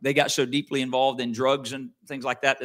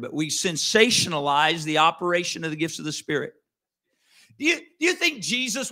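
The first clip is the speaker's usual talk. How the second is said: ordinary talk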